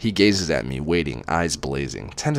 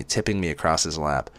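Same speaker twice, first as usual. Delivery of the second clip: ordinary delivery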